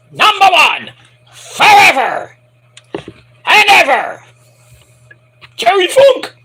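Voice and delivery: high, loud, drawn-out announcer call; stretched arena intro shout; announcer hype. Acted. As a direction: bellow it like a ring announcer. Hyped ring announcement.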